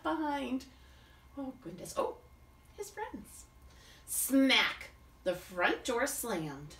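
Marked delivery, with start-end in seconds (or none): none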